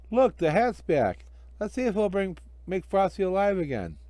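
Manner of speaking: put-on high hopeful kid voices; eager children. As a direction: kids trying the hat again.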